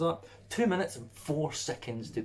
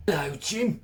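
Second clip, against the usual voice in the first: louder, chirpy British greeting; mock British accent; 4 semitones higher, 5 dB louder than usual. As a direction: greet him like a cheery Brit. British reviewer voiced.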